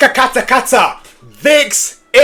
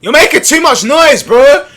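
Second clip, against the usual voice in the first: raised, higher scolding call; loud scolding; 7 semitones higher, 5 dB louder than usual. Real, not acted. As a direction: shout it at a noisy friend. Scolding clip character loudly.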